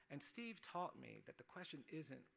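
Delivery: ordinary talk, nothing marked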